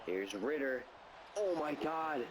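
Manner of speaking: running sportscaster commentary; play-by-play call. Acted. Gamer calling the final drive, play-by-play. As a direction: narrate each snap like a broadcast. Plays announcer over Madden game.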